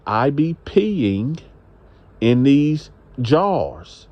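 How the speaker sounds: spelled-out slow, stretching each word with pauses; slow, deliberate repeat. Retold or imitated. Woman repeats herself to a man who questioned her, slow and deliberate. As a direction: repeat it very slowly so it gets across. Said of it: "Come again, what you say?".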